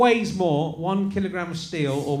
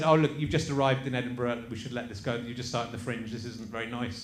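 much softer and lower; quiet retold plea; 7 semitones lower, 10 dB quieter than usual. Host retelling his guest's kind tweet, soft and low. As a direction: drop right down to a low, hushed aside. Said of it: "But then you just said".

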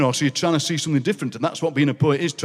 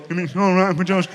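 a hint of badly fitting teeth; imitating Alan; 3 semitones higher than usual. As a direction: lightly mimic the chuckling workmate. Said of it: "What he actually said was".

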